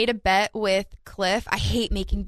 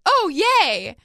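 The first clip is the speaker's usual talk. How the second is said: higher, louder, cheerful mom cheer; imitating mom; 9 semitones higher, 5 dB louder than usual. Retelling mom cheering a pick six, higher and brighter. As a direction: lightly play a clueless happy mom. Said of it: "my mom was like".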